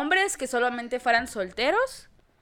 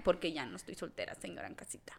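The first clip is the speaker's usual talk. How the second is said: whispered aside; 6 semitones lower, 13 dB quieter, faster than usual. Real, not acted.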